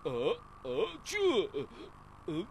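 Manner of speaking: startled what. Acted. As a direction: jump in surprise. Startled question.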